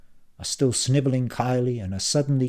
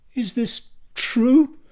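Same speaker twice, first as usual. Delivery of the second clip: shocked question; 13 semitones higher, 7 dB louder, much slower than usual. A narrator's reading. Tula's shocked slow question.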